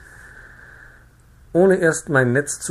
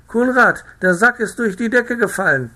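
high, loud, alarmed wife's cry; alarmed woman; 12 semitones higher, 6 dB louder than usual. A narrator's reading. The farmer's wife cries out that something fell through the ceiling. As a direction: shout the news out in fright. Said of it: "rief eine Weiberstimme".

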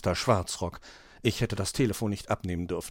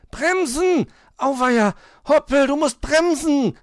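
very high, loud cry of alarm; panicked shout; 14 semitones higher, 7 dB louder than usual. A narrator's reading. Cat to friend on runaway sledge, panicked high shout. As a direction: yell it as the sledge runs away. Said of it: "rief Mauz".

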